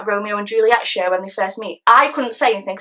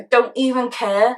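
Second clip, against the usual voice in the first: slow, word-by-word defiance; spoken up; slower than usual. Acted. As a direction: spell it out slowly after being told off. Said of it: "Stop mumbling".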